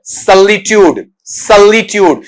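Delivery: "सॉलिट्यूड" drawn out as suhl-li-tyood; schwa sound. Shown as a demonstration. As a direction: say "सॉलिट्यूड" slowly with a schwa first, ty not ch. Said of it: "ये भी स्वा sound है".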